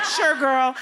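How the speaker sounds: high, loud, sassy brush-off; hammed-up sassy dismissal; sarcastic sure. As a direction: humour her with mock approval. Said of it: "kinda like".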